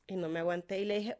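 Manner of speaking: ordinary talk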